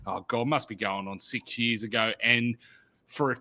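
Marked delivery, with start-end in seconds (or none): none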